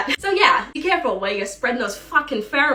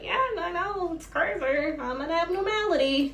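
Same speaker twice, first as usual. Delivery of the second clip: awkward reply; 6 semitones higher, 6 dB quieter than usual. Retold or imitated.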